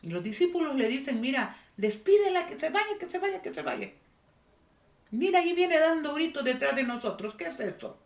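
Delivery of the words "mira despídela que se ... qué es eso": high-pitched, insistent grumbling in imitation; mock complaint in a raised pitch; pestering disciples. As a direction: nag as a fed-up group, repeating the demand. Disciples urge Jesus to dismiss her.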